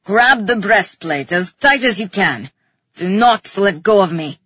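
low, loud, forceful order; urgent low-pitched roar; roared command. A narrator's reading. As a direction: bark it out deep and hard. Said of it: "he roared".

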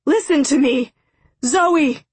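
high, urgent long-distance shout. A narrator's reading. Father demands his daughter listen, shouting. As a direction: yell for her attention, rising on the name. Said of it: "her father shouted".

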